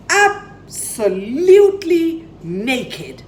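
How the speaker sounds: ordinary delivery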